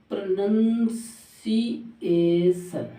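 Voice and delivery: प्रोनंसिएशन very slowly, pro-nun-see-ay-shun; broken into syllables. Shown as a demonstration. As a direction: stretch each syllable of प्रोनंसिएशन, with NUN second. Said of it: "अब ये बन जाएगा प्रनन". (प्रोनंसिएशन धीरे-धीरे, नन के साथ)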